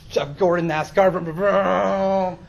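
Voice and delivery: loud, revving engine noises; race car imitation. Mimics race driving among oncoming taxis.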